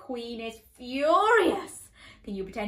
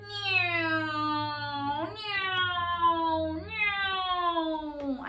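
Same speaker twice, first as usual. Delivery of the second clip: mock meow; 7 semitones higher, much slower than usual.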